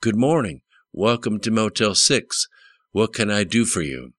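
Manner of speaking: a hint of Mississippi drawl from a friendly motel clerk. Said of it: "said in a Mississippi drawl".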